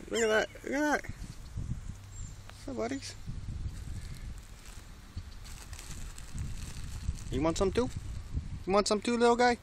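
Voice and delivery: high, sweet pet talk. Real, not acted. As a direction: coax the little birds with a sing-song voice. Baby talk to the birds.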